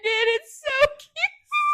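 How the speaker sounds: very high, loud, drawn-out gush; real melting squeal over something adorable. Real, not acted. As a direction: squeal it slowly in delight. Gushing over the sweet scene.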